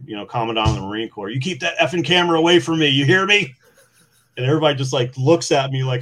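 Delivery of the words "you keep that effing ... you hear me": higher, louder angry yell; retold scream. Wounded Marine yelling at the Commandant over the camera, loud and angry. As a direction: snap it at him like a furious wounded Marine. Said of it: "start screaming at the Marine".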